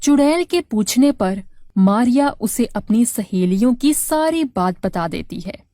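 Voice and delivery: usual speaking voice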